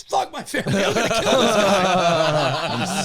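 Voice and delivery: high, loud hammed-up rage; comic retold fury breaking into laughter; mock raging threat. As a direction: imitate a buddy set on a fight, dragging out the end.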